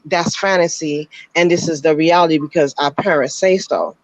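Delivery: ordinary delivery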